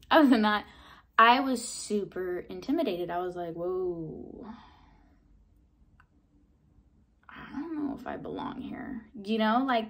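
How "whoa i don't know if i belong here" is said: inner thought. Retold or imitated. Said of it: "that thought in my head".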